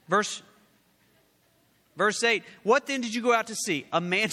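fiery preacher reading the text aloud; full-force preaching. Acted. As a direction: proclaim each line to the back row. Reads scripture at full force.

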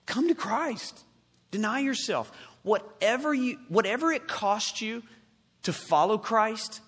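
high, lifted preacher's call; urgent preaching appeal. Real, not acted. Preacher urging listeners to follow Christ, urgent appeal.

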